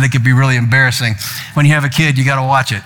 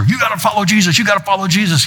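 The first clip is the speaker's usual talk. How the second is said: high, mock-preachy nagging; preachy grandpa; 7 semitones higher than usual. Grandpa lecturing a little girl, high mock-preachy. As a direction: nag like a pushy preacher grandpa. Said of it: "the grandpa who sweeps in and tells her".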